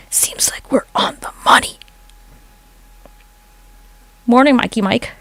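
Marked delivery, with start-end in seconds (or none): 0.1-1.8 hushed conspiratorial whisper. Real, not acted. Streamer whispering a secret to viewers, hushed. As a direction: lean in and whisper the hint. Hinting TikTok censors her.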